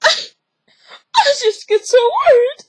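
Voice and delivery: very high, slow, loud whine; hammed-up weepy fretting, drawn out; mock tearful mother. Mother fretting to her daughter, mock tearful wail.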